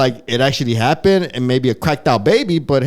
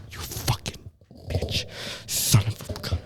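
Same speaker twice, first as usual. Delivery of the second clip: breathy, hushed, much softer curse; 6 semitones lower, 14 dB quieter, slower than usual. Retold or imitated. Retelling Elvira cursing Tony at the restaurant, hushed and soft. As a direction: spit the insult under your breath.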